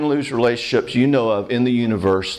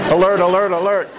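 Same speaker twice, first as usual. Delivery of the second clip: mock alarm call; 6 semitones higher than usual. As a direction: call out the alarm as a playful warning. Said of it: "You know, it's like".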